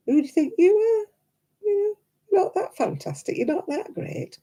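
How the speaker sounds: a hint of a scolding mother; mum in my ear. Her mum's voice in her ear, lightly mocking scold. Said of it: "My mother's there".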